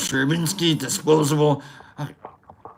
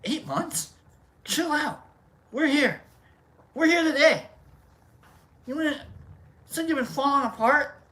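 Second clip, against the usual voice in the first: quiet high fast instructor; soft high coach voice; 5 semitones higher, 5 dB quieter, faster than usual. Acted. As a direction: teach it in a hushed squeaky voice.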